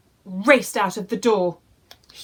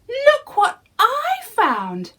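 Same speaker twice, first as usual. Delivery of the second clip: high, slow proud call; excited boy; 4 dB louder, slower than usual. Acted. Jack showing his mother the golden hen, high and proud. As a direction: show off the treasure. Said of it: "he said".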